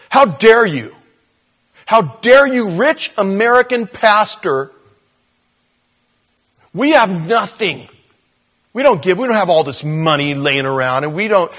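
high, loud indignant tirade, retold; an offended pastor shouting back at the preacher; retold angry yelling. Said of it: "yelling at me".

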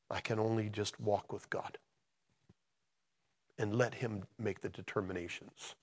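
halting, hushed, with long pauses; emotional. Real, not acted. Preacher sharing recent events in his life, emotional. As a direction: speak softly and haltingly, holding back feeling. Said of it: "I get all emotional about this stuff".

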